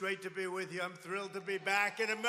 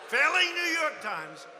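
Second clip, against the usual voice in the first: mocking; 5 semitones higher, 8 dB louder than usual. Real, not acted. Mocking taunt, shouted slowly.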